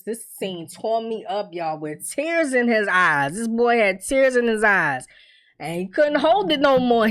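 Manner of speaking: normal talking voice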